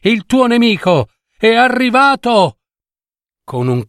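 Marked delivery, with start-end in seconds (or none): 0.0-2.6 crying out. Acted. Mother Nie calling to her son, desperate cry. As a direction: call out to warn her son. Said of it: "gridò madre Nie".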